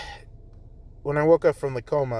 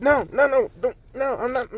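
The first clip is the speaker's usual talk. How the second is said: high, panicked string of no's; scrambling to deny it; flustered denial; 10 semitones higher than usual. Acted. Jiro pulling away, denying.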